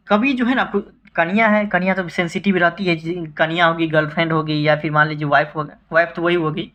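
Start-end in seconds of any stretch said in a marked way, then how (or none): none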